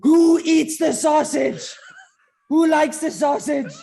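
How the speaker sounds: high, loud, slow sarcastic interrogation; mocking manager. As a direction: grill the squad like an angry Italian boss. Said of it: "he's like".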